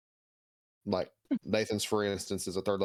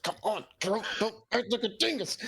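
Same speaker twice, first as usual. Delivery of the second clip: much higher nudging voice, pushy buddy; Asirio pushing Gerald; 11 semitones higher than usual. Acted. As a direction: shove him along with a high teasing voice.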